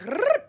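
high revving toy car noise. Retold or imitated. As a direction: imitate a pull-back toy car. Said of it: "they're like".

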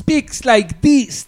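exaggerated Israeli accent, each word dragged; imitated Israeli accent. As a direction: mimic an actress's Israeli accent, stretched out. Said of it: "הישראלים שונאים את המבטא שלה".

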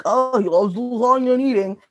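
mock dopey quote, run together; mocking imitation. Mocking the fat joke to co-hosts, put-on voice. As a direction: send up a lazy joke with a put-on voice.

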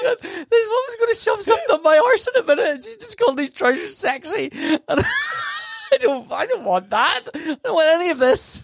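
high-pitched, squeaky disbelief through laughter; laughing protest. Real, not acted. Comedian recalls nurse calling the paper shorts sexy, laughing protest. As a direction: protest helplessly while cracking up, voice way up high.

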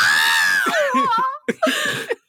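high shrieking laugh; long, high burst of laughter; big laugh. Real, not acted. Laughing hard after protest.

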